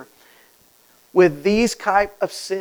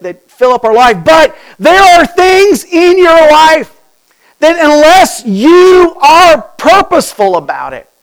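louder and higher, urgent preaching; impassioned Paul paraphrase; 10 semitones higher, 13 dB louder than usual. Retold or imitated. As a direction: plead it like the apostle. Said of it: "He's saying".

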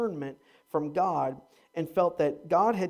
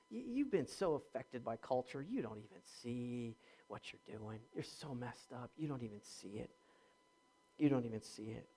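much softer, low and grave; hushed rebuke; 7 semitones lower, 15 dB quieter than usual. Retold or imitated. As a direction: drop to a near murmur of reproach. Paul's grave rebuke, voiced.

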